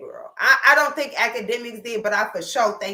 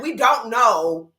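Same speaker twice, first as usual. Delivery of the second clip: usual speaking voice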